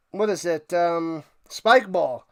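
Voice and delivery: ordinary talk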